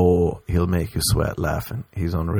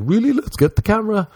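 excited tourist; 13 semitones higher than usual. Retold or imitated. Gullible tourists get excited.

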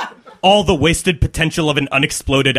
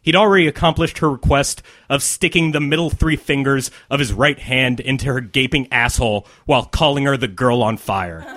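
plain reading voice

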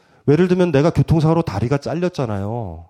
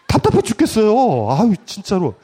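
usual speaking voice

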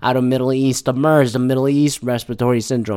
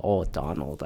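weary sigh; 10 semitones lower, 11 dB quieter than usual. Retold or imitated. Exasperated sigh at Trump.

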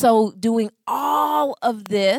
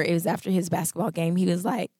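ordinary delivery

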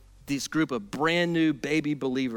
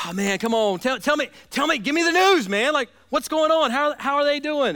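higher, louder, impatient begging for news; eager pleading; 8 semitones higher, 4 dB louder than usual. Retold or imitated. Paul begs Timothy for news from Thessalonica, eager and higher. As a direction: tell me now, can't wait. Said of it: "he's just gotta be like".

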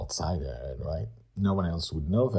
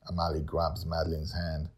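ordinary delivery, nothing marked

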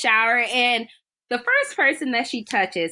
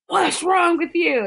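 higher, mock-exasperated demand; gym teacher's rebuke; 7 semitones higher than usual. Gym teacher slapping Carrie, higher mock rebuke. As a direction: imitate scolding someone you just slapped. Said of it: "slapped her and, like".

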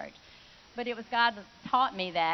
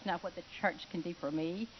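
normal talking voice

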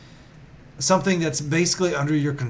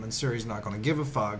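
normal talking voice

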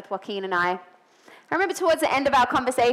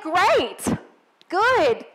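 stock upbeat answer; 8 semitones higher, 3 dB louder than usual. Retold or imitated. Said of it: "that's my answer all the time".

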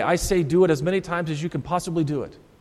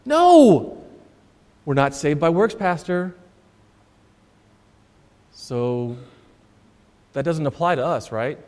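mock Lutheran objection; 4 semitones lower than usual. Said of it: "we hear that and think".

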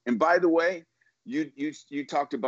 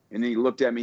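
ordinary delivery

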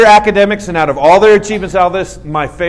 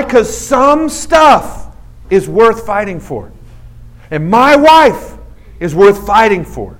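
preaching climax; 3 semitones higher, slower than usual. Acted. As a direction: build it like a sermon peak. Sermon climax, building.

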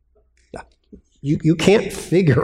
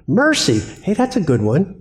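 higher, pleased little exclamation; mock delighted chooser; 6 semitones higher than usual. Preacher mocking a churchgoer choosing mercy, lightly delighted. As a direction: sound like you just found a bargain. Mocking believers picking God's traits.

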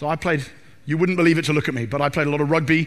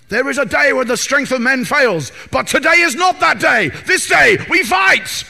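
higher, louder, building to a shout; Aragorn battle speech; 6 semitones higher, 6 dB louder than usual. Acted. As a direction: rouse the army to fight. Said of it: "I wanna fight for Aragorn".

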